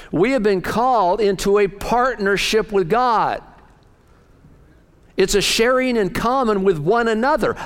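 preacher exhorting his congregation; fervent preaching. Acted. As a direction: drive the message home.

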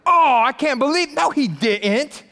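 hammed-up "no he didn't"; mock outrage. The ten disciples outraged at James and John, high mock disbelief. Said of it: "That's what they're mad".

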